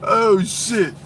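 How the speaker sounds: normal talking voice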